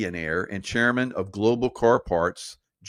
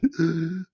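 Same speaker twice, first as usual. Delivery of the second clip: acted gasp; 7 semitones higher than usual. Acting out swollen breathing.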